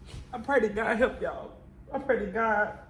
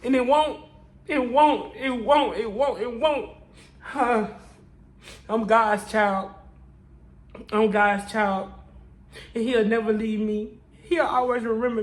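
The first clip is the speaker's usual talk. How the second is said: preaching cry; 3 semitones higher, 4 dB louder than usual. Real, not acted. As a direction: declare it like a sermon. Testifying, crying out to God.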